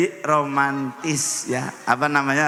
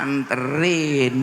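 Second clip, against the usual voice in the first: slow, drawn-out, lightly lower "Anterin"; coy wife; 3 semitones lower, much slower than usual. Retold or imitated. Wife twisting her shirt hem answering her husband, slow and coy. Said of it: "begini nih ngomongnya".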